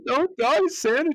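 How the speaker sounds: put-on high, loud child's plea; little girl pleading. Little girl begging Santa not to die, high pleading. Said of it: "And the little girl's".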